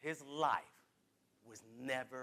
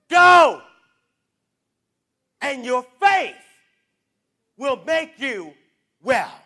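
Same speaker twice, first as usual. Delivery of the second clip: loud declared repeat; shouted blessing; 2 semitones higher, 12 dB louder, slower than usual. Real, not acted. Preacher repeating the blessing, loud declaration. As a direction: proclaim it to the whole church.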